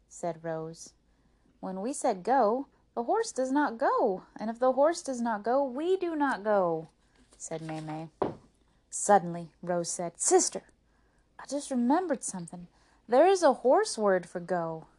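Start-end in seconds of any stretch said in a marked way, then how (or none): 1.6-6.9 exasperated complaint. Acted. May May complains about horse. 10.2-14.9 high, loud, eager announcement; sudden realization. Acted. Rose telling her sister about a horse word, excited.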